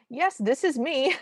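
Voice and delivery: high, slow, mock phone answer; Sadie. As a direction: imitate a cartoon lady answering the phone. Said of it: "She goes".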